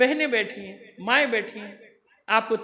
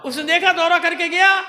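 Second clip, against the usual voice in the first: loud, higher preaching shout; full-force preaching; 7 semitones higher, 8 dB louder than usual. Real, not acted. Preacher tells the enemy scout's tale, full-force shout. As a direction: build the story at full cry.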